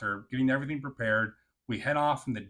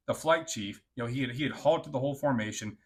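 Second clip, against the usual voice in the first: ordinary delivery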